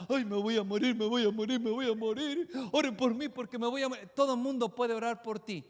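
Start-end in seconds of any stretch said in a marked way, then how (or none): 0.1-4.1 mock whimpering "I'm going to die"; hammed-up frightened churchgoer; whiny fearful believer. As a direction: play the panicky believer begging for prayer. Preacher mocks fearful believer.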